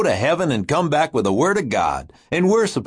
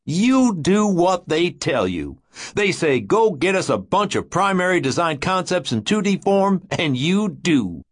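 withering tone; 10 semitones higher than usual. Acted. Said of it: "his tone was withering now".

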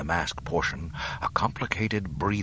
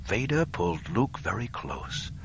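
usual speaking voice

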